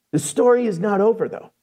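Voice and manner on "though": normal talking voice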